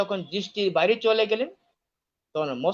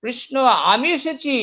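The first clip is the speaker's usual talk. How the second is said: higher, louder call to Krishna; louder, insistent announcement of arrival; 9 semitones higher, 8 dB louder than usual. Acted. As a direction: raise the voice so he must hear. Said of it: "আরো জোরে বলেন".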